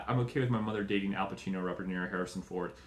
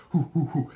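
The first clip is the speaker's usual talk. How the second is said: louder, higher taunting hoo sounds; mocking hoots; 6 semitones higher, 6 dB louder than usual. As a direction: taunt the cops while dodging. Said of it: "the bad guy's just like".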